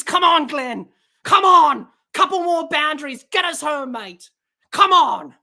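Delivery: will him over the line; urging on. Real, not acted. Urging Maxwell home.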